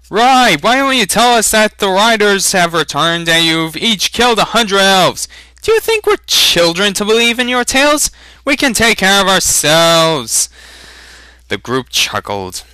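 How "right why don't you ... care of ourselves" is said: high, loud jeering shout from the crowd; mocking holler. Villager heckling the traitors, shouting high and mocking. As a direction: yell it back, scornful and loud. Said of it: "Someone hollered".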